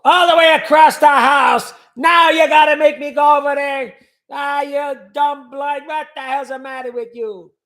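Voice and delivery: put-on loud, high grumbling old Italian man; angry grandfather. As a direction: shout like a fed-up grandpa. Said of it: "that's how my grandfather talked".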